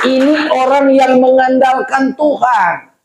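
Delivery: high, emphatic sermon delivery; forceful preaching. Real, not acted. Preacher quoting scripture to the congregation, high and forceful. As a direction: drive the verse home to the congregation.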